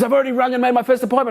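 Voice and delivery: high, fast, breathless excitement; excited rush. Retold or imitated. Mate bursting in with golf pro news, excited. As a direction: blurt the news out.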